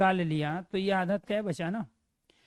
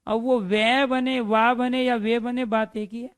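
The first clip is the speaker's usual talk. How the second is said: emphatic teacher; 3 semitones higher, 6 dB louder than usual. Shown as a demonstration. Teacher to students, stressing that वे and वा are one rule, loud and emphatic. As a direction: hammer it home to the class, louder and higher.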